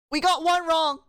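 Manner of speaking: high, loud exclamation; startled outcry. Real, not acted. Exam taker reacting to one wrong answer, startled and loud. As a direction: blurt it out after a gasp.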